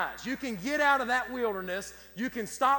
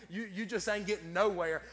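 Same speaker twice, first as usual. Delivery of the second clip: usual speaking voice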